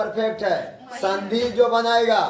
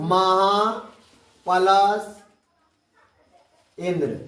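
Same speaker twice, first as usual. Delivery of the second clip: dictation; 2 semitones lower, slower than usual. Shown as a demonstration. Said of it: "अगला लिखना".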